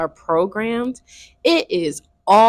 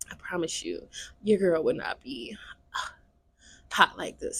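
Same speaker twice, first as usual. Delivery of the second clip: whispering; 3 semitones lower, 10 dB quieter than usual. Real, not acted. Confiding aside about the heat.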